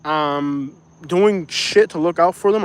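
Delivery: usual speaking voice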